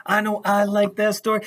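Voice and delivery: ordinary delivery, nothing marked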